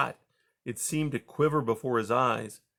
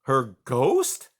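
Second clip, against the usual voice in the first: exclaiming; 5 dB louder than usual. A narrator's reading. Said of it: "exclaimed Junior".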